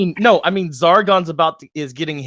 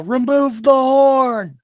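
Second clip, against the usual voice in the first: high, loud, drawn-out battle yell; 9 semitones higher, 5 dB louder, much slower than usual. Acted. Ymir yelling to remove the horn, drawn-out yell. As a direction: bellow it out slowly as an order. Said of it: "Ymir's gonna yell".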